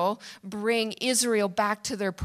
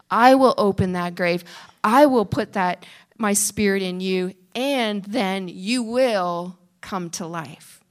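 emphatic divine promise; 2 semitones lower, 3 dB louder than usual. Acted. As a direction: pronounce God's promise over the crowd. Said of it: "His word is so emphatic".

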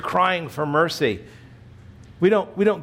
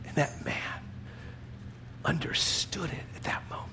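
hushed whisper; 13 dB quieter than usual. Real, not acted. Whisper carried on after quote.

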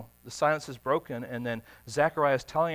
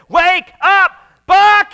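loud, high shout right in his face; shouted wake-up call; 16 dB louder, much slower than usual. Retold or imitated. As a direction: yell it to jolt a sleeper awake. Friends shouting to wake Buck.

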